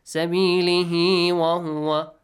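loud Quranic chant joining the words without a pause; continuous recitation. Shown as a demonstration. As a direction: recite straight on through the stop. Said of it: "If you continue reading".

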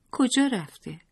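put-on high childlike question; storybook child voice, lightly acted; lightly voiced child. A narrator's reading. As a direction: ask it as a small child, pitched well up. Child asks where father went.

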